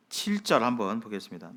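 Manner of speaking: normal talking voice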